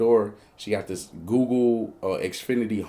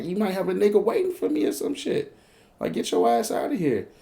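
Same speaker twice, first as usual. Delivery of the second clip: high-pitched wary accusation; retold suspicion, pitched well up; suspicious retort; 10 semitones higher than usual. Man searching her crib, suspicious retort.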